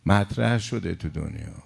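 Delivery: normal talking voice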